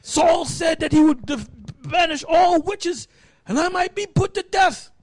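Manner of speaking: mildly fearful, high-pitched protest; acted fearful reluctance. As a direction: play a worried witch warning of danger. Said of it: "she probably held back".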